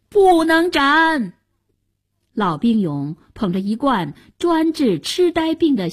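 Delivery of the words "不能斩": high, slow drawn-out cry; urgent shout. A narrator's reading. Old soldier intervenes before an execution, drawn-out high shout. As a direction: call out to halt an execution. Someone calls out to stop execution.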